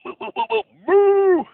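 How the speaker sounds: very high mock cow mooing, loud at the end; high, squeaky string of moos. Shown as a demonstration. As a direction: moo like a cow for the class. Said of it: "one more, cow".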